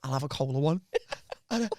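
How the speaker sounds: low, hushed aside to a workmate; low furtive murmur. Retold or imitated. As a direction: murmur it low, like a secret errand. Acting out sneaky ice pop runs.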